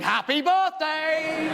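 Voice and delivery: big drawn-out greeting; exuberant stranger. Retold or imitated. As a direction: sing it out slowly to surprise someone. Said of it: "a complete stranger standing there going".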